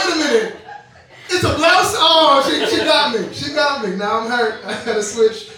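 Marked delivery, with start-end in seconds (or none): 0.1-1.9 high, loud dawning outrage; delayed realization. Retold or imitated. Ten days later, realizing the compliment was a diss, outraged. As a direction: realize the insult all at once. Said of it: "To be like".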